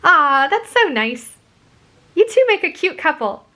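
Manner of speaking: high, gushing voice; bubbly girl. A narrator's reading. Girl gushes to her friend, bubbly and high. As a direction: coo over a cute couple. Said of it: "a bubbly voice".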